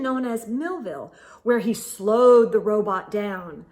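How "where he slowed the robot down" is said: usual speaking voice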